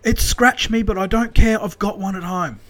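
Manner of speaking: high, loud excited imitation; thrilled caller, insisting on the catch; excited son. Joe insists excitedly on the phone.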